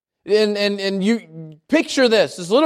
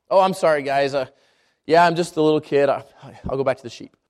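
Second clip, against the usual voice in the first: low, sheepish apology; meek backing down; 10 semitones lower, faster than usual. Acted. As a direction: shrink away as a little kid, voice dropping. Acting David meekly backing down.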